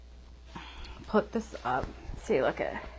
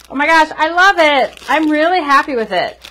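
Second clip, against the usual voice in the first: delighted; 5 semitones higher, 5 dB louder than usual. Real, not acted. Delighted by her own work.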